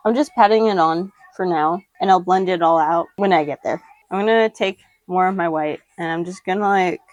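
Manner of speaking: normal talking voice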